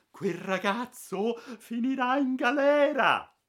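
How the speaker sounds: high, slow, loud huffy scolding; a prim lady's spiteful prophecy; irritated. Acted. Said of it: "borbottando tutta stizzita". (stizzita, acuta e lenta)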